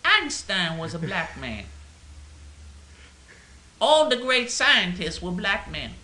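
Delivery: mock preacher voice. A comic put-on orator voice, mock earnest. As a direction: proclaim it like a mock civil rights speaker. Mocking voice of activists' claims.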